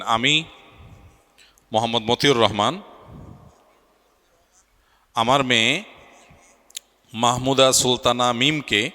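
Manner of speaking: short phrases with rising ends, left open for repeating; feed the words piece by piece, pausing after each; dictating vows. Acted. Officiant prompting the bride's father to repeat, measured dictation.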